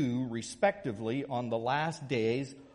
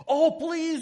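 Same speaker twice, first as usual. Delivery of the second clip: high, loud, begging cry; begging students; 8 dB louder than usual. Retold or imitated. Late students begging the professor, high loud plea. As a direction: plead like desperate students. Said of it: "they begged".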